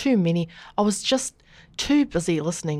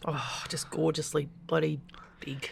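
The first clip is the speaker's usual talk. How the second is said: whispering; 5 semitones lower, 11 dB quieter than usual. Pretend. Breathy whisper about toes.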